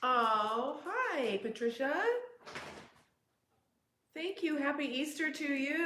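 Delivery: high, loud cheerful hello; excited greeting. Real, not acted. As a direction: greet her brightly and loudly. Greeting on video call.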